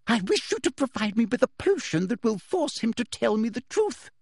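high, quivering demand; rising fury; furious Umbridge. A narrator's reading. Umbridge demanding truth potion from Snape, high and furious.

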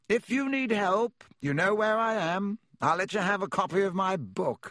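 high, airy pompous man; grandly condescending celebrity; loud, self-important. A narrator's reading. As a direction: boast breezily, pitched well up. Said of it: "Lockhart was saying loudly".

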